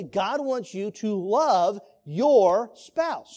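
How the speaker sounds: slow, each word weighted, "love your spouse" drawn out; emphatic. Real, not acted. Preacher driving the point home to the class, emphatic. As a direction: press it home word by word, slowly. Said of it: "uh, emphatically".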